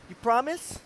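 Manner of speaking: put-on high, timid little girl asking; scared little girl. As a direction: ask like a frightened nine-year-old. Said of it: "And she goes".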